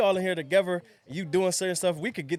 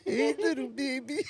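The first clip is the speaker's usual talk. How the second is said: put-on slow, high, hushed old man; creepy old man voice; 9 semitones higher, 4 dB quieter, slower than usual. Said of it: "just said, like, a creepy old".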